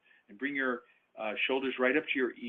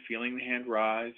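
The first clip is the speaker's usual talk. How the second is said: guided breathing. Acted.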